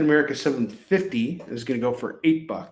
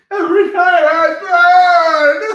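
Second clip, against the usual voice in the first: high, loud, slow crying shout; hammed-up Crow wailing; 8 dB louder, much slower than usual. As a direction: sob and scream like a grieving ghost. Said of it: "Do you guys like my pitch for The Crow?".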